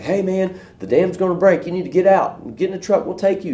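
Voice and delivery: high, pressing call from the truck; urgent rescuer. Retold or imitated. Rescuers in a truck urging the stubborn man to leave, high and pressing.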